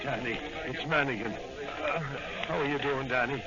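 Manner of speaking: low, soft concern for a wounded friend; worried and tender. Acted. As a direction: gently rouse the fallen partner. Partner over wounded Danny.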